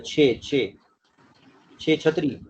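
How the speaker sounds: छे with a clear ch as in छतरी; ch sound. Shown as a demonstration. Teacher repeats छे for confused student, clear ch. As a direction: stress the ch of छे छतरी. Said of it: "सीएच की छे की sound है".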